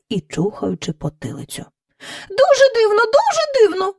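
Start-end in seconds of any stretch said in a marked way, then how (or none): 2.3-3.9 much higher chorus echo; courtiers echoing. Acted. Courtiers echo the king, much higher. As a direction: mimic the king like fawning courtiers. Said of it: "сказали придворні й собі".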